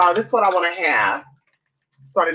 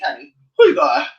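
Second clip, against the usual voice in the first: ordinary talk, nothing marked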